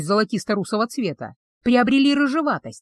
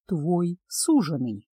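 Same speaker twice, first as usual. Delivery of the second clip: lower, softer and very slow; slow mysterious male; 5 semitones lower, 5 dB quieter, much slower than usual. Acted. Betrothed reveals himself to the witch, slow and soft. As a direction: let the word linger, unhurried.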